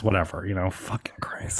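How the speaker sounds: unvoiced whisper, fading out; whispered aside. Real, not acted. Podcaster muttering about her own tarot, whispered. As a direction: breathe it away under your breath.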